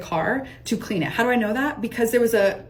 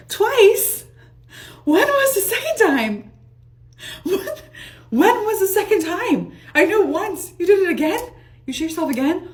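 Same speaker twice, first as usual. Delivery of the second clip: high, loud, incredulous outburst; shocked disbelief; 10 semitones higher, 4 dB louder than usual. Retold or imitated. To her friend after the confession, loud shocked disbelief. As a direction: react as if you can't believe it.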